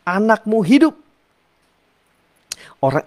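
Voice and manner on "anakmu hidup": high ringing word of authority; commanding Jesus. Retold or imitated. Jesus tells the father his son lives, ringing with authority. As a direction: declare it with power. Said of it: "kata Yesus kepadanya".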